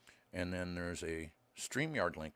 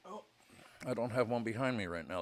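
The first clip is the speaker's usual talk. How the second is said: ordinary delivery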